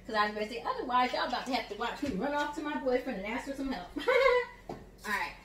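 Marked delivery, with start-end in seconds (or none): 3.9-4.6 high-pitched burst of laughter; real short, high laugh. Real, not acted. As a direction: laugh at your own joke. Joking about needing boyfriend's help.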